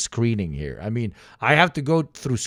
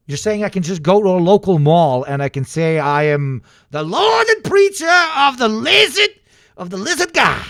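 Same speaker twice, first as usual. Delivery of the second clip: shouted high, hammed-up title; rising to a mock shout on "Lord and preacher of the lizard"; mock proclamation; 7 semitones higher, 5 dB louder than usual. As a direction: bellow it like a mock prophet. Mocking self-declared church leader.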